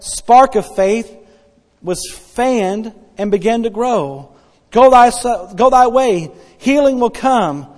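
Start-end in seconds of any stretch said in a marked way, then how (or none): 4.7-7.7 loud command. Acted. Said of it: "Jesus speaking again".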